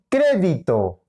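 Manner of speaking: "crédito" slow, stressed as CRE-di-to; stress on first syllable. Shown as a demonstration. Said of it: "La ubicación del acento". («crédito» con acento en la primera: CRÉ-di-to)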